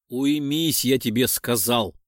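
usual speaking voice

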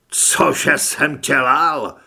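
slow, loud incredulous question; outraged disbelief. Acted. Carlos outraged at threat. (pobouřená, pomalá hlasitá otázka)

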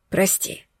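breathless whisper of an apology; barely voiced, just lips moving. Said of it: "произнесли его губы".

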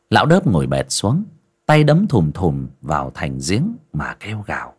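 ordinary delivery, nothing marked

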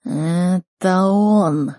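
very slow talking-beast voice; drawn-out creature accusation; Никс. Acted. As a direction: stretch it out like an accusing predator. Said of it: "продолжал Никс".

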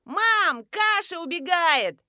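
put-on high, loud child-like call; piercing yell. A narrator's reading. Child alerts mum to porridge boiling over, piercing yell. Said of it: "закричал пронзительный голос".

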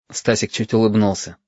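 ordinary delivery

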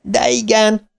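high panicked yell; yelling back. A narrator's reading. Stan yells back at Bill, high panicked yell. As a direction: scream it back at him. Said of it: "üvöltötte vissza Stan".

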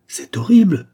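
high, loud exclamation; shocked outburst; horrified cry. A narrator's reading. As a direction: cry out in horror. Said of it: "s'écria sa mère".

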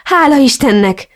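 heartfelt high-pitched thanks; relieved exclamation. A narrator's reading. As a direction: let the relief burst out. Relieved greeting at the reunion.

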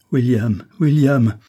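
a mother's desperate appeal, building; impassioned plea. Acted. Isabelle calls to her dying son, impassioned plea.

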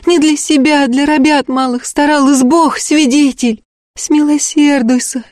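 very high, lamenting entreaty; frantic high-pitched peasant pleading; wailing plea. A narrator's reading. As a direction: wail and beg for mercy. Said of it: "Снова раздался дикий вой".

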